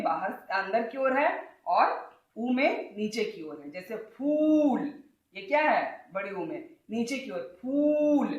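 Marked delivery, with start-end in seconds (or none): 4.2-4.8 फूल with the ऊ drawn out; slow फूल, ऊ held long. Shown as a demonstration. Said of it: "लंबा बोल रही हूँ". 7.6-8.3 फूल held out high on the ऊ; long, high-pitched फूल. Shown as a demonstration. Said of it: "लंबा बोल रही हूँ".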